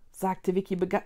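plain reading voice

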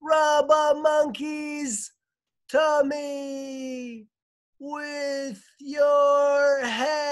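very slow, high, long-held cry; wailing far-off shout, each word drawn out; distant echoing call. A narrator's reading. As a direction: stretch every word to carry across the water. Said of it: "Jane's voice echoed back across".

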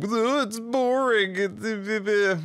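high, drawn-out mock complaint; mocking naysayers. Gamer quoting the odd people who dislike the game, mocking. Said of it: "outside of the odd people saying".

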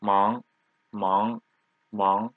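repeated Mang, each with a rising tone; second tone. Shown as a demonstration. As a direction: lift the pitch through each Mang. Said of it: "Second tone".

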